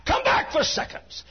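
high, loud, half-sung preaching cry; shouted preaching. Acted. Preacher voicing God's call over the organ, shouting.

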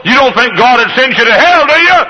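rising high and loud; tempting voice. Acted. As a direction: goad him with a mocking question. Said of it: "the other voice said".

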